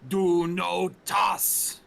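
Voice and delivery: slow, loud, booming echo; unearthly voice. Acted. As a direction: boom it out slowly like a voice filling a chamber. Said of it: "an echoing voice throughout the entire chamber".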